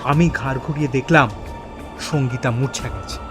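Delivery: ordinary delivery, nothing marked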